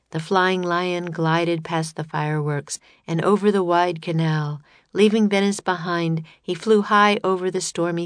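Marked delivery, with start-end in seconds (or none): none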